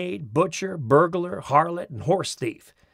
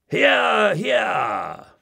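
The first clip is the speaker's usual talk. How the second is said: cheering hear hear; 6 semitones higher, 3 dB louder than usual. Retold or imitated. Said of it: "you encourage an orator by exclaiming".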